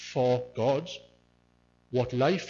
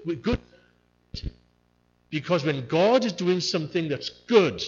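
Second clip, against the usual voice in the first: usual speaking voice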